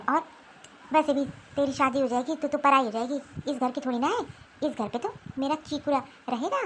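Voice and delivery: put-on an old woman brushing off the girl's tears; stern grandmother. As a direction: grandmother scolding her granddaughter coldly. Stern grandmother scolds girl.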